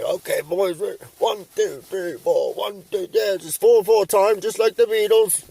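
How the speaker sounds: put-on high, hammy count-in as the drunk dad; old drunk dad counting in. Drunk old dad counting in the jamming boys, hammed up. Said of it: "and he'd be".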